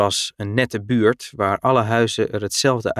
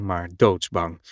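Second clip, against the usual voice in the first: ordinary delivery, nothing marked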